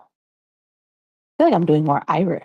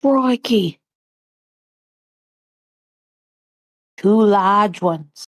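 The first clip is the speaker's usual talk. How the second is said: put-on Australian accent; 3 semitones higher, slower than usual. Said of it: "I can't do the Australian accent".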